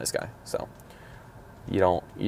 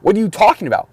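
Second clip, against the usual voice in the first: higher, louder, arguing his case; indignant protest; 7 semitones higher, 4 dB louder than usual. Retold or imitated. Protesting to the driving examiner, indignant and raised. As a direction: insist the man was already gone. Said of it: "I'm like".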